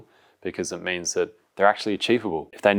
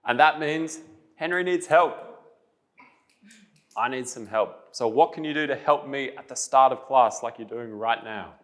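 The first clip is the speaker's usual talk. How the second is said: higher-pitched, clearly projected questioning; classroom teacher voice; 7 semitones higher than usual. Real, not acted. Teacher asking the class how they can help, in classroom voice.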